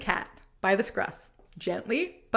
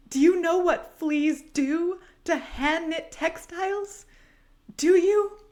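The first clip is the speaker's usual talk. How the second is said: melodramatic wail; 6 semitones higher than usual. Acted. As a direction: build the hysterics until she shrieks. Drama queen's melodramatic meltdown.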